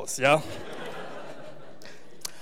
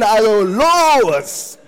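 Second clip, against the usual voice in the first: rousing call; 6 dB louder, much slower than usual. Real, not acted. Urges congregation to have children.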